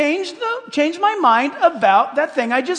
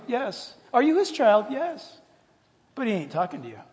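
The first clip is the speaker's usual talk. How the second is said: usual speaking voice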